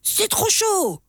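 put-on high, slow kid's wail; child's cry. A narrator's reading. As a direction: yelp it like a little kid. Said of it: "et crie".

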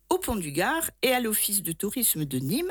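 ordinary delivery

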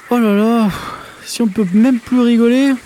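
oh là là aigu et fort; râle en haussant la voix; exclamation vexée. Acted. Grenouille vexée s'exclame. (exclamation vexée, haute et forte)

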